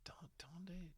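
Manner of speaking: whispered, halting echo of the question; confused whisper. Retold or imitated. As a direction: whisper it back, unsure. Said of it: "And I'm like".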